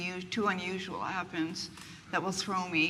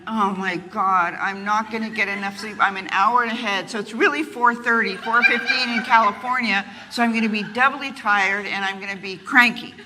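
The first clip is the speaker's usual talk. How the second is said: retold panic; 9 dB louder than usual. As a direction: fret out loud, voice rising in worry. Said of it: "I went".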